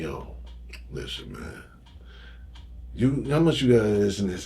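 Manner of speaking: low, furtive murmur, nearly voiceless at first; retold whisper. Crackhead discreetly asking the dealer for product, imitated hushed whisper. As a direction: imitate someone sidling up with a secret question. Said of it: "crackhead whispered some shit to me".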